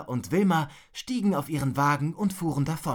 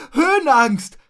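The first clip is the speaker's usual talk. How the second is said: loud, high cry; shouted taunt; 9 semitones higher, 11 dB louder than usual. Acted. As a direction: blurt it out after a sneeze. Finishes shout after sneezing. (laut und hoch herausrufen)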